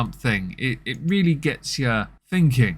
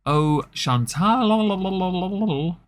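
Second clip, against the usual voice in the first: drawn-out teasing call; 7 semitones higher, much slower than usual. Pretend. Mocking Chantal about the bathtub photos, long teasing drawl. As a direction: stretch the name out slowly and knowingly, higher.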